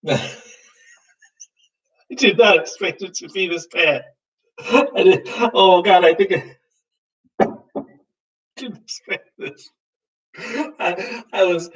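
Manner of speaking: high laughing disbelief; laughing and gasping in disgust. Real, not acted. Laughing and choking at taste.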